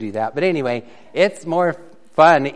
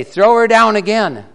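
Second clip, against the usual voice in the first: much higher, louder shout on down; stronger command; 6 semitones higher, 8 dB louder than usual. Acted. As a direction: yell the order again, harder. King repeats the order.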